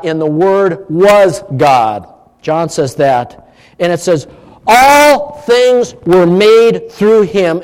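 solemn Bible reading, unhurried; scripture reading. Acted. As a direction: proclaim the verses deliberately. Reads scripture aloud.

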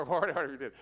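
normal talking voice

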